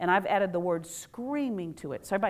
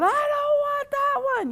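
put-on much higher, louder, slow child refusing; whiny kid; 5 dB louder, slower than usual. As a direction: pout it out like a picky child. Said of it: "You have kids".

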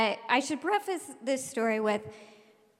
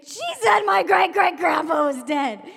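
high, loud outraged wail; screaming complaint; 6 semitones higher, 5 dB louder than usual. Retold or imitated. Upset girl telling on her classmate, screamed complaint. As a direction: yell it like a wronged child tattling. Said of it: "Zakaya was screaming".

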